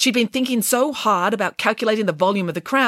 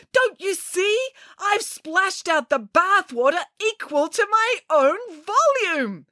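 high, drawn-out revelation; excited cry; 13 semitones higher, slower than usual. A narrator's reading. Scientist explains breakthrough to housekeeper, excited cry. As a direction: announce the discovery high and slow. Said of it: "she cried".

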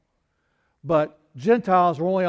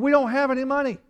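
Peter's joke, pitched much higher; wry Peter; 8 semitones higher, 3 dB louder than usual. Retold or imitated. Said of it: "Peter's going".

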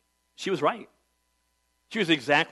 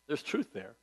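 hushed echo; 2 semitones lower, 12 dB quieter than usual. Acted. Quiet echo after emphatic line.

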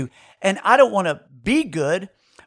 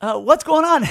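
imitated wife, higher pitch; Karen; 8 semitones higher than usual. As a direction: play the unsuspecting wife, pitched up. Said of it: "She says".

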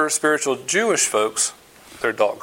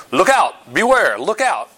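emphatic warning; 6 semitones higher, 4 dB louder, slower than usual. Retold or imitated. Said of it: "This is clearly emphatic".

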